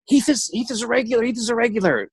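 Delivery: high-pitched gossiping crowd; imitated excited chatter; mock excited buzz. Acted buzz of amazed people.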